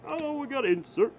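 excited. Real, not acted. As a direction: blurt it out, thrilled by the pull. Insert card pulled.

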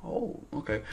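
whispered oh okay. Shown as a demonstration. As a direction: breathe it out as quiet listener feedback. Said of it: "give you feedback with their faces".

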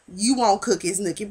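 normal talking voice

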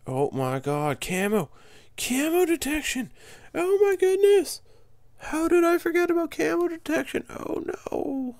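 very loud, high yelling in dismay; frustrated shout. Real, not acted. Player realizing he forgot camo detection, shouting in dismay. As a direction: yell out at your own mistake.

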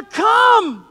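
high loud summons; Jesus calling Peter onto the sea; calling out Come. A narrator's reading. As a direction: call across the water. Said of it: "he said to Peter".

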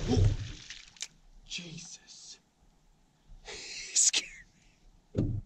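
breathless whisper after a scare, with a laugh; startled whisper. Real, not acted. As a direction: gasp it out right after the fright. Startled by fish or animal.

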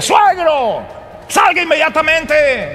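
high, pounding yell at the door; furious son-in-law hammering the door; angry shout. Acted. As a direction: bellow for the father-in-law to come out. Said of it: "Si quieren ver a un hombre enojado".

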